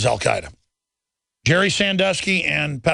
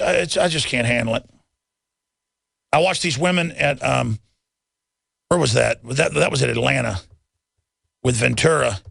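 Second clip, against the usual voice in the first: usual speaking voice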